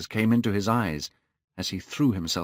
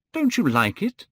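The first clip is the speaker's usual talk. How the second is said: high, eager raised question; crying out; 11 semitones higher, 4 dB louder than usual. A narrator's reading. Painter to the silent boy, crying out. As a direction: call it out high, rising at the end. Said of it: "cried Hallward".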